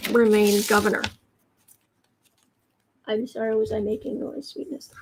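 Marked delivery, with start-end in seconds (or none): 3.1-4.9 soft pet talk. Real, not acted. Apologising softly to her pet.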